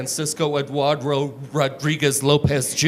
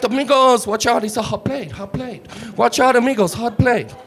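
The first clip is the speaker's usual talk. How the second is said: put-on high, bright Mexican accent; higher Mexican-accented waiter patter; 8 semitones higher than usual. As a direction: rattle off the menu in a Mexican accent. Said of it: "an impression of any waiter at any Mexican restaurant".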